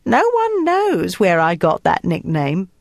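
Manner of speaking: affected Cockney with airs; elocution-trained Cockney. A narrator's reading. Woman brushes off nickname question, affected Cockney. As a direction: put on refined airs over a Cockney voice. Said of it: "sounded like a Cockney who had been to elocution lessons".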